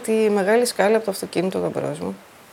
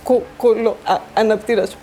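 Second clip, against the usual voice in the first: stammering; slower than usual. Real, not acted. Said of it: "πρώτη φορά τρέμει έτσι η φωνή μου".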